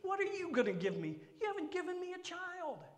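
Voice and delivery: high, loud, whining voice; whiny Abram. Acted. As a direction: whine about the missing child. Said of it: "Doesn't that sound kinda whiny?".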